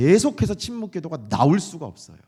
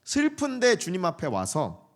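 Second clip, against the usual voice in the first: ordinary talk, nothing marked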